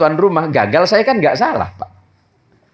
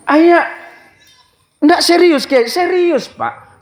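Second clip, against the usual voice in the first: protesting host; 13 semitones higher, slower than usual. Retold or imitated. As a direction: plead that it's no joke. (memprotes dengan suara tinggi dan keras)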